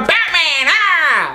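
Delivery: laughing mock yelp of "Batman, ah!"; dorky cry. Ranting vlogger mimics the extra, laughing mock yelp. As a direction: toss off the goofy line mid-laugh. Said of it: "he gone".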